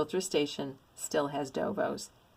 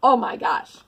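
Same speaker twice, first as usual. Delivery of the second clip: gasping delight; 4 semitones higher, 9 dB louder than usual. Real, not acted.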